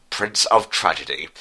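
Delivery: usual speaking voice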